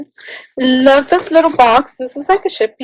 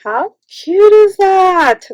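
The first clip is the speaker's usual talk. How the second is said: higher, slow, adoring; drawn-out gush; 8 semitones higher, 5 dB louder, slower than usual. Real, not acted. Vlogger showing a Christmas scene, adoring drawn-out gush. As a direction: stretch it out lovingly. Gushing over a craft gift.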